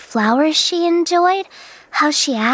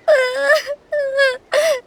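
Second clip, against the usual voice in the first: tearful sob; 8 semitones higher than usual. Acted. Scared girl breaks into sobs.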